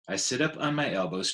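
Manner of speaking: plain reading voice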